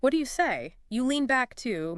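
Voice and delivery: usual speaking voice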